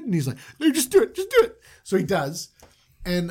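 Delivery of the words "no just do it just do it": high, loud, urgent order; squeaky, exasperated insistence; frantic insistence. Acted. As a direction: push it through in a high-pitched fluster. Boss urgently overrides guard's objection.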